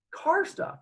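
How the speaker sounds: very high, rising questioning echo; sceptical echo. Retold or imitated. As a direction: repeat it as a disbelieving high question. Officers echo his answer in disbelief.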